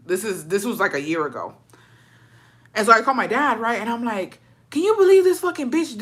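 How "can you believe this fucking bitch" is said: high-pitched, indignant venting; worked-up, high retold rant; heated. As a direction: vent in outrage, voice shooting up. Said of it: "He was heated too".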